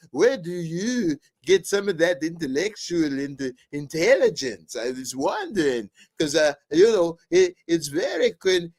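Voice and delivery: high, swooping, halting intellectual voice; hammed-up impression, pitch leaping and falling; Jordan Peterson. Guest professor flattering Joe, mock intellectual impression. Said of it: "I had to talk like Jordan Peterson".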